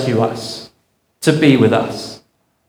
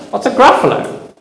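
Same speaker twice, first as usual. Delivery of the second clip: fox; 9 semitones higher than usual. Acted. Fox asks in story.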